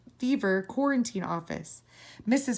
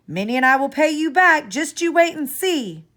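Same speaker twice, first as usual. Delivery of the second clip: raised, higher playful call; 6 semitones higher, 7 dB louder than usual. A narrator's reading. Child calls to Travis while flying kites, raised and playful. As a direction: call across to a friend, teasing. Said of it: "I called to Travis".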